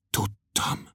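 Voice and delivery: barely voiced, very soft; whispered mutter. Berzsián muttering to Klopédia, whispered and soft. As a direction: breathe it out in defeat.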